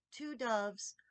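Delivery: ordinary delivery, nothing marked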